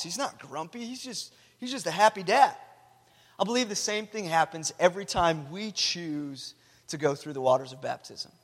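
ordinary talk

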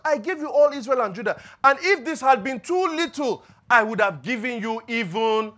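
loud, high proclaiming of God's words; full-force preaching. Acted. Pastor voicing God's rebuke to David, preaching loudly. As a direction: thunder it out as a prophet.

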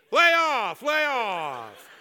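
loud, high frustrated outburst; fed up, yell at them to back off; exasperated yell. Retold or imitated. Newcomer snapping back at the shouting ladies. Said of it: "you're thinking".

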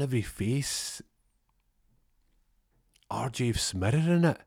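normal talking voice

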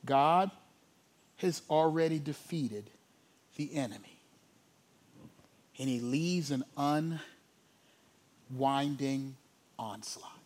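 very low, soft, long pauses between phrases; hushed preaching. Real, not acted. Preacher telling of the enemy's defeat, hushed. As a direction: drop almost to a whisper and let each phrase land. Hushed, solemn sermon climax.

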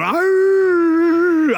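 high, loud joyful yell; a wild worshipper's yell; wild holler. Retold or imitated. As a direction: let out an untamed cry. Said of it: "running up and down the aisles going".

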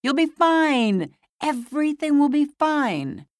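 high, loud reassuring shout in the head; inner shout. Retold or imitated. Inner voice shouting reassurance at the frightened patient, high and loud. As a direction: shout it like a voice inside her head. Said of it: "as if someone were shouting".

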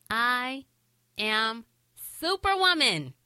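"I", "am", "superwoman" as separate beats, rising high on "superwoman"; self-affirmation. Shown as a demonstration. Host modelling an affirmation for mothers, "I am superwoman" in proud separate beats. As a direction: declare "I am superwoman" word by word, lifting "superwoman" high. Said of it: "So say it to yourself".

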